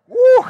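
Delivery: a high, loud whoop; giddy exclamation; dizzy woo. Retold or imitated. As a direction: let out a spinning-head whoop. Mimics dizzy spinning.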